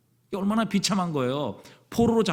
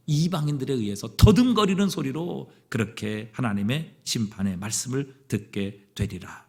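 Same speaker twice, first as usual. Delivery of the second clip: ordinary talk, nothing marked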